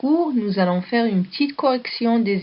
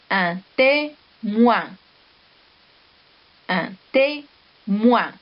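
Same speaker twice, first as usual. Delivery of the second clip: very slow, each word drawn out for a class; teacher dictating words at half speed; slow dictation; much slower than usual. Shown as a demonstration. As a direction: dictate each word slowly to young pupils. Dictating words twice to children.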